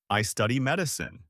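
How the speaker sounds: ordinary talk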